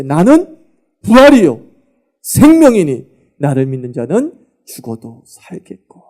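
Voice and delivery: loud, ringing proclamation; Jesus proclaiming, loud and bold. Acted. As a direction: declare it with full authority.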